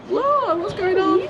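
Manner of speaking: high, slow alarmed cry; retelling her own panic with the leads; startled whoa. Retold or imitated. Owner retelling the tangled leads, high startled cry. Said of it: "I was in a bit of a".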